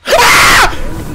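very loud, high shriek; startled scream. Real, not acted. Player hit by a jump scare, startled scream. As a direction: scream out at a sudden fright.

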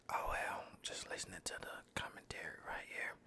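ordinary talk, nothing marked

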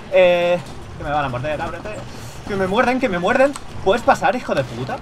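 high, loud panicked yelling; panicked shouting. Real, not acted. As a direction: yell it as zombies close in. Zombies close, panicking at door.

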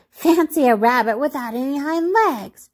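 high-pitched jeering rabbit; storybook jeer, without laughing; mocking amusement. A narrator's reading. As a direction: make fun in a high character voice. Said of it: "he began to laugh".

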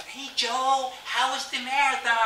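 high, loud, upbeat phone hello; cheery greeting. Retold or imitated. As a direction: chirp it as the unsuspecting sister. Sister greets him cheerily by phone.

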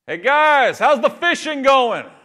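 loud, high, friendly call across the water. Acted. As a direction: shout it cheerfully to the men out in the boat. Jesus calling out to the boat.

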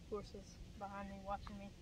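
normal talking voice

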